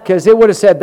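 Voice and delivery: ordinary talk, nothing marked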